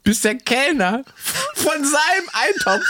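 hoch und lachend durch die Worte; lachend erzählt. Real, not acted. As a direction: kann sich beim Erzählen kaum halten. Erzählt lachend die Kellner-Geschichte. (lachend erzählt, Stimme hoch)

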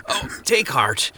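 slow croaked words; croaking. A narrator's reading. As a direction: croak it out slowly. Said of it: "Goodwill croaked".

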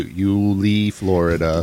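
slow, sinking narrator voice; true-crime YouTuber. Retold or imitated. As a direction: imitate a grave YouTube narrator. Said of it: "that that's how he sounded".

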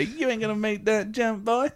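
high, drawling movie voice; demo movie line. Shown as a demonstration. Host demonstrating the film line to co-host. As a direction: show him how the line goes. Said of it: "quickly say".

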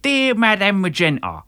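put-on high, loud South London accent. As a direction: read the letter as a Londoner. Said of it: "I'll do the South London".

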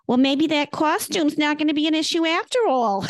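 put-on deadpan joke in a New York accent. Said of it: "in her New York accent".

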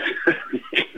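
short breathy nervous laugh; embarrassed unvoiced chuckle. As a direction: laugh it off awkwardly. Awkward admission about addictive cocoa.

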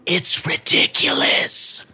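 ordinary delivery

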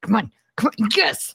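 building excited come on; rooting hard, rising to a yes; eager cheering. Retold or imitated. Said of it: "You're just like".

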